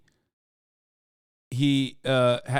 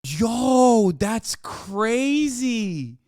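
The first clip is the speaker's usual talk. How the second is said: high, drawn-out shocked reaction; a viewer who can't believe what he just heard; stunned disbelief; 8 semitones higher, 4 dB louder, much slower than usual. Real, not acted. Streamer reacting to the interview clip, stunned and drawn out.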